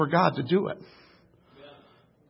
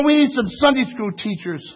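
ordinary delivery

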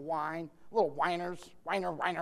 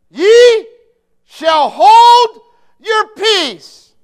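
very high and loud, stretched slowly; booming Moses; 25 dB louder, much slower than usual. Acted. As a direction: thunder it down like Moses. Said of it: "Moses said to him".

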